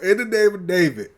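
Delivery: usual speaking voice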